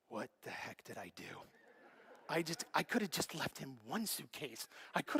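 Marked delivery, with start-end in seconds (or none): 0.1-1.2 much lower, softer, muttered regret; quiet dismay. Retold or imitated. Waking up on the flight home, quietly regretting the gift. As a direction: mutter it to yourself, half-stunned.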